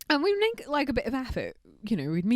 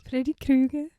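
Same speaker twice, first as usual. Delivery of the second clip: a hint of a Scouse accent; 5 semitones higher, 3 dB louder than usual. Shown as a demonstration. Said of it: "saying the name Freddy Krueger in a Scouse accent".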